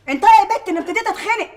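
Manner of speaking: high, sharp burst of impatience; exasperated outburst. Acted. Mistress fed up with the silent girl, exasperated outburst. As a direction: lose patience, pitch jumping up. Losing patience with crying girl.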